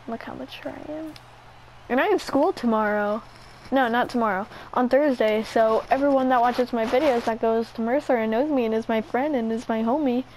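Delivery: normal talking voice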